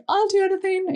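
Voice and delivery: high-pitched eager yes; eager self quote. Retold or imitated. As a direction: squeal it like an overeager newbie. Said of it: "I was like".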